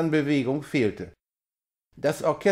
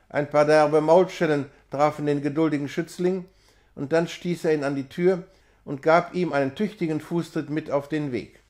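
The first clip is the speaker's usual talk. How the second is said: usual speaking voice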